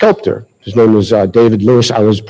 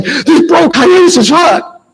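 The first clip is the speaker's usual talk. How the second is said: high-pitched, drawn-out blurt of a stage line; over-the-top outburst; much slower than usual. Retold or imitated. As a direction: blurt it out way too big, stretching every word. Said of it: "I said it in a way that I shouldn't have".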